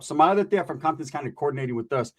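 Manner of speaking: normal talking voice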